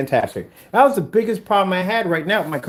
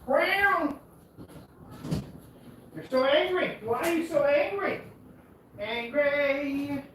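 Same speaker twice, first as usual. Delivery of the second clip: cooing at cat; 8 semitones higher, 7 dB quieter than usual. Real, not acted.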